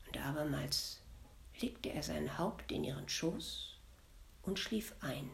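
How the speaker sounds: soft whisper so he sleeps; whispering so the devil stays asleep; whispered narration. A narrator's reading. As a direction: hush as if not to wake him.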